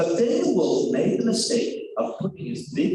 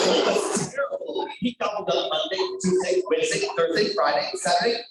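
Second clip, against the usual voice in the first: plain reading voice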